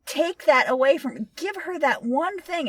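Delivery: high, indignant plea, loud at the start; pissed-off protest. Retold or imitated. Podcaster protesting the heroine losing her moment, pissed-off plea. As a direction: punch the first word, then plead in exasperation. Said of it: "I was so pissed with that".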